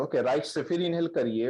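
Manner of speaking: usual speaking voice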